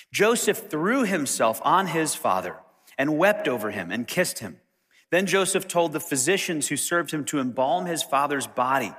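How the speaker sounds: ordinary talk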